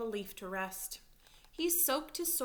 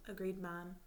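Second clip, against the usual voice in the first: plain reading voice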